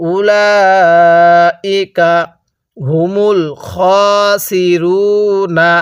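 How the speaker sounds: উলাইকা with a very long four-alif stretch; Quranic recitation. Shown as a demonstration. Teacher demonstrating four-alif elongation, slow recitation. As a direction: hold the vowel very long and slow. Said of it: "চার আলিফ টান দিব".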